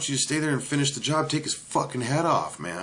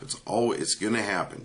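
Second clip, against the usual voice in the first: usual speaking voice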